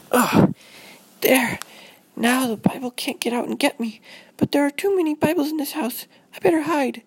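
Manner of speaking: put-on high, hurried child voice; high-pitched fretful kid; anxious little girl. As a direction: play a scared girl talking to herself. Voices Robin barricading the Bible.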